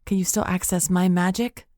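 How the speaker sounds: ordinary delivery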